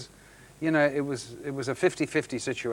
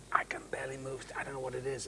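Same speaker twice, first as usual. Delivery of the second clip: strained whisper; 12 dB quieter, faster than usual. Retold or imitated. Retelling being in pain on set.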